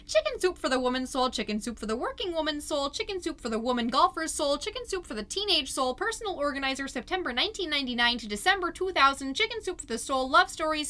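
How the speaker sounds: fast deadpan run of titles; rattled-off list. Acted. Mom listing junk titles in the box, fast and building.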